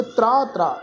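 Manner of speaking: ਤਰਾ ਤਰਾ with a half r after t; ਤਰਾ ਤਰਾ said as a teaching model; careful pronunciation. Shown as a demonstration. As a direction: say ਤਰਾ ਤਰਾ with a clear tr cluster. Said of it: "ਇਹ ਸ਼ਬਦ ਦਾ ਪਾਠ ਉਚਾਰਨ ਕਰਾਂਗੇ".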